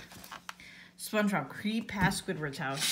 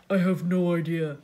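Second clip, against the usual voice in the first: lightly voiced slow-witted figure, louder; Patrick; 5 dB louder than usual. A narrator's reading. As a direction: say it dully, taking your time. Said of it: "he said slowly".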